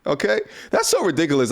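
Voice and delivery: ordinary talk, nothing marked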